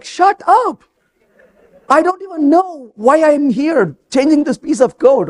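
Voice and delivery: high-pitched, mildly exasperated comeback; frustrated retort. Retold or imitated. Coder to boss asking if it's done, frustrated. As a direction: act out pushing back, pitch jumping up. Acted snap back at impatient boss.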